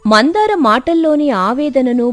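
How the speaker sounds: ordinary delivery, nothing marked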